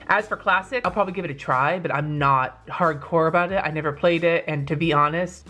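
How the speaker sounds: ordinary talk, nothing marked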